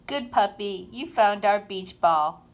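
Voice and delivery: usual speaking voice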